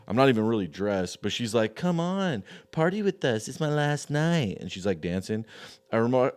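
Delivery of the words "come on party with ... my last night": put-on girly pleading voice. Girl begging him to party.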